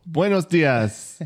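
stretched, slow Buenos dias; slow drawn-out greeting. Pretend. Host greets the guest with a slow drawn-out buenos dias. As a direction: draw the greeting out slowly.

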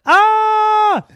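a high, loud startled "Ah"; startled cry. Acted. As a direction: yelp in sudden alarm at the time.

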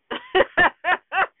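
a sudden high cackle at her own joke; high burst of laughter. Real, not acted. Laughing at her jailbird joke about Nathan, high burst of laughter. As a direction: let a high laugh break out.

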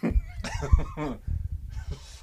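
high wheezing laugh; shrieking laugh. Real, not acted.

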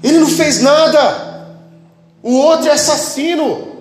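high, drawn-out plea to a crowd; acted protest.

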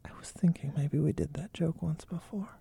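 whispered; hushed tones. Pretend. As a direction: keep it to a breathy whisper. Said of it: "we start speaking in hushed tones".

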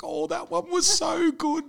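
imitating a gushing fan. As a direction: gush like a starstruck fan. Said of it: "she's like".

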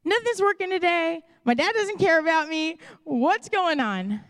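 high, pleading mock frustration; frustrated outburst. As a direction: throw up your hands, half-wailing. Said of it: "moment of just pure frustration".

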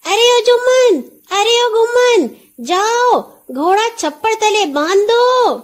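high, loud call to the servants. A narrator's reading. Nora calls servants to tie up the horse, loud call. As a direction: call across the yard to the boys. Calling servants across the yard.

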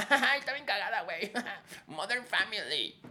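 high, laughing fan voice; laughing mock fan; amused viewer. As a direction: giggle it like a delighted viewer. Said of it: "La gente es como".

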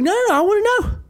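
very high, loud hammed-up scolding; coach laying into kid. Coach won't let student put toys away, explosive scolding. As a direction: blow up at the student, pitch soaring. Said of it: "He starts laying into this kid".

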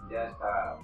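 ordinary talk

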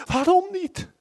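veel hoger en luid, bijna schreeuwend; wanhopige kreet. Retold or imitated. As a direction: laat de vraag uitbarsten naar de hemel. Repeated cry, even higher. (hoge luide kreet naar God)

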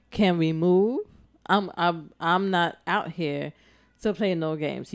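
usual speaking voice

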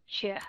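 "sure" softened to a light schwa. Shown as a demonstration. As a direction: let "sure" go light and reduced. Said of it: "đọc nhẹ đi".

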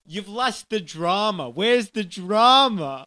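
high, slow, drawn-out cry; wailing, over-the-top director; theatrical director outburst. Acted. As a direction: demand drama with hammed-up grandeur. Director berates actors in sketch.

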